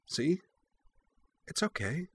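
put-on very soft, high child's voice; gentle coaxing. A narrator's reading. Cora holds out her hand to the puppy, coaxing gently. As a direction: coax it closer softly, pitched well up.

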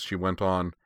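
ordinary delivery, nothing marked

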